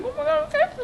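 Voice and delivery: high-pitched mock panic; squeaky imitation of nerves; retold nervous fluster. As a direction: re-enact being flustered, way up high. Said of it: "got so nervous".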